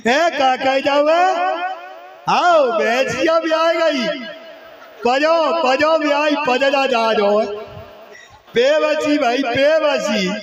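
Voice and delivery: mock elder bellowing down a phone line; old man shouting on phone. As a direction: play a grandfather who thinks he must shout to be heard far away. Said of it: "बस जो चिल्लाएंगे".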